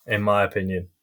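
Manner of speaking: ordinary talk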